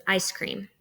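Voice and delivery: "ice cream" with both syllables stressed equally. Shown as a demonstration. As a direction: give "ice" and "cream" the same weight. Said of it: "equal stress on both syllables".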